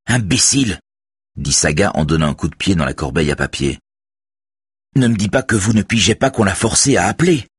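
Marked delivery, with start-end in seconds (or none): 0.1-0.8 sharp, higher angry snap; angry insult. A narrator's reading. Saga insulting colleagues while kicking the wastebasket, angry snap. 4.9-7.5 high, loud exasperated retort; angry outburst. A narrator's reading. Saga berating her colleagues for not getting it, loud angry exasperation.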